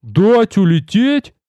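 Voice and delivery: high, drawn-out disbelief; stretched-out amazed question; incredulous question. A narrator's reading. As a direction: ask slowly, voice rising in astonishment. Namesake astonished at sparing bird.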